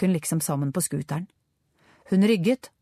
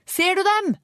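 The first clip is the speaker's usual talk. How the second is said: high, urgent call; calling out to a companion, high-pitched; shouted question; 13 semitones higher, 4 dB louder than usual. A narrator's reading. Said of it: "ropte hun".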